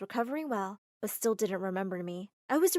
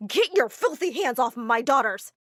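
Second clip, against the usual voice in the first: louder, slow furious order; outraged louder command; protective anger; 8 dB louder than usual. A narrator's reading. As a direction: bark at him to back off. Father protects daughters.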